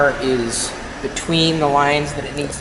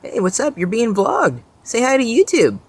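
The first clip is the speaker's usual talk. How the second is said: high, sing-song pet talk; greeting a bunny in the yard, high cooing voice; 9 semitones higher than usual. Real, not acted. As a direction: coo at the little rabbit. Talking to a bunny.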